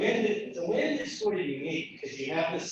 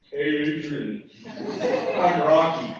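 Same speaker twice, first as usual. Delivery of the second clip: slow, loud Rocky Balboa voice; drawn-out boxer voice; Rocky impression; 2 semitones lower, 11 dB louder, much slower than usual. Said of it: "So Rocky the raccoon".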